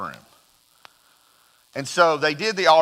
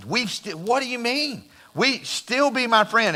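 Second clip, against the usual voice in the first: imitated begging; 9 semitones higher than usual. First grader begs his best friend not to drop him, pleading. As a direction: plead in disbelief, pitch leaping up. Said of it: "crying and just begging him".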